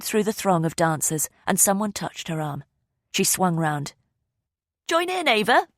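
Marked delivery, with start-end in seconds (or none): 4.9-5.7 high, cheery call above the band; yelling over music. A narrator's reading. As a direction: invite her onto the dance floor. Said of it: "yelling above the music".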